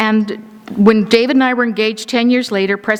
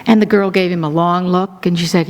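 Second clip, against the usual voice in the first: ordinary talk, nothing marked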